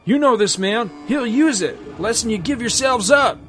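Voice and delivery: high, loud panicked pleading; terrified hostage. Acted. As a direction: beg in a panic with a gun at his ear. Hostage with gun at ear.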